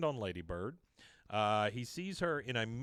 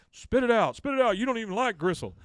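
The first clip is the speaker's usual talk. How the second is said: high, loud outraged order; angry shouting; 10 semitones higher, 9 dB louder than usual. Acted. Hank to Bobby chewing gristle, angry shouting. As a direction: shout it like an exasperated father. Father orders son at dinner.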